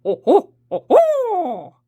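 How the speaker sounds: high, loud hooting ho ho; barred owl hoot. Retold or imitated. Showing viewers how the owl sounds, loud high hoot. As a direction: hoot like an owl across the woods. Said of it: "it kinda sounds like this".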